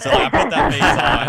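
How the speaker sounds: laughing high and loud through the line; real laughter breaking over the words. Real, not acted. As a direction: giggle the words out, amused at herself. Laughing about her resting face.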